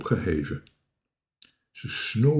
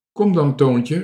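raised, higher call; 5 semitones higher, 6 dB louder than usual. A narrator's reading. Children call up to Toontje, raised voice. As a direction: urge him down with a shout. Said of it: "riepen de kinderen".

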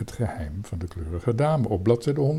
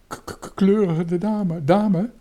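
high, stuttering, startled question; stammering; 8 semitones higher, 5 dB louder than usual. A narrator's reading. Boy stunned by the captain's order, high stammer. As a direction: stick on the first sound, then blurt it high. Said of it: "hakkelde Toontje".

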